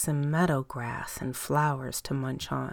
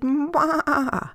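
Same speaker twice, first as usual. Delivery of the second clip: much higher little goat call; high bleat; 9 semitones higher, 5 dB louder than usual. Acted. Goat calling up the taller mountain, high bleat.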